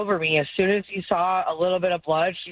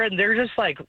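ordinary talk, nothing marked

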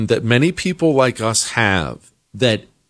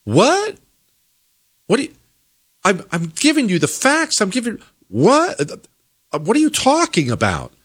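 high, disbelieving What, stumbling over the words; incredulous; 8 semitones higher than usual. Retold or imitated. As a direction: act baffled at someone ignoring the facts. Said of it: "looking at them like".

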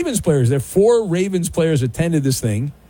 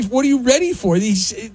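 usual speaking voice

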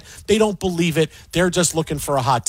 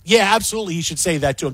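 ordinary talk, nothing marked

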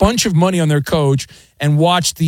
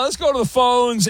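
ordinary talk, nothing marked

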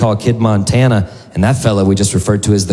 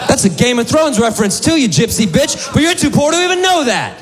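very high, hammed-up comeback; gleeful mock tirade; mock taunting outburst. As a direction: hurl the insult in a strained high voice. Imagined comeback hurled at woman.

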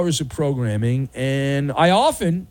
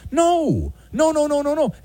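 much higher, squeaky run of no's; emphatic refusal; 11 semitones higher than usual. Acted. Owner refuses the neighbor's sandwich, squeaky and frantic. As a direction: cut him off with frantic no's.

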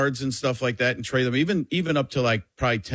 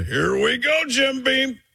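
higher excited Romo punchline; Tony Romo impression; 12 semitones higher, slower than usual. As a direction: Here we go pitched up high.

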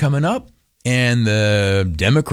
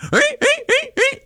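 put-on high, squeaky old-man cackle; grandpa cackle. Grandpa laughing at his own joke, high squeaky cackle. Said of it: "like that".

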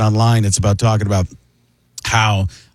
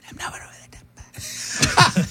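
hushed, high whisper; breathy whisper; 6 dB quieter, slower than usual. Shown as a demonstration. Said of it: "do it quietly".

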